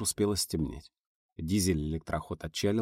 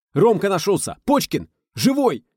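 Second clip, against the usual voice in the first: excited shout; 12 semitones higher, 8 dB louder than usual. Acted. Joyful news shouted.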